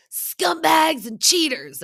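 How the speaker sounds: slow, high mock battle cry; hammed-up yell, drawn out and loud; Sandoval. Sandoval smashing the wall, imitated high yell.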